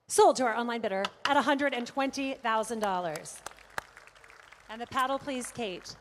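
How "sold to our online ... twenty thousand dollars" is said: sold call. Real, not acted. Auctioneer closes the sale.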